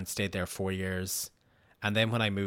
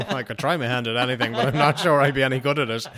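higher, much louder, hammed-up keen newcomer; mock eager reply; 5 semitones higher, 10 dB louder than usual. Answering his new Australian bosses about paneling, hammed-up loud and high. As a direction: overplay the game-for-anything rookie, loud and high. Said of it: "Yeah, I'm like".

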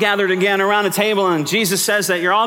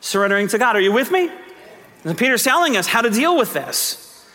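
ordinary delivery

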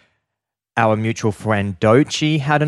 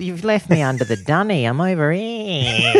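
Australian woman assistant; 8 semitones higher than usual. Pretend. Phone complains it's under the dunny, mock Australian woman. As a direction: wail it as a comic Australian woman. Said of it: "mine's got the Australian women's, uh, voice".

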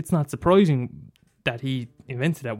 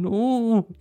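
dismayed no; 9 semitones higher than usual. Retold or imitated. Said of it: "you're watching it going".

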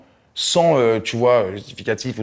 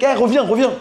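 high loud call after him; mock yelled call; 13 semitones higher, 5 dB louder than usual. Retelling calling out after the fleeing driver, high and loud. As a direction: shout after the driver to come back.

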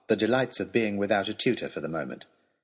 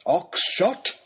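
high warning cry; fed-up lordly rebuke; exasperated scolding; 8 semitones higher, 3 dB louder than usual. Acted. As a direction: call his name in outrage. Lord scolds the baronet.

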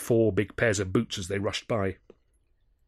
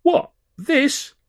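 feigned innocence; 7 dB louder than usual. Acted. Malden feigning innocence about club.

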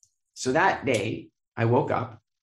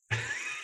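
sudden high squeal of laughter; real laugh; 11 dB quieter than usual. Real, not acted. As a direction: burst out laughing at the memory. Laughing at the memory.

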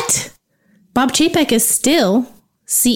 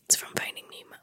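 whispering; 17 dB quieter, much faster than usual. Real, not acted. Whispered aside.

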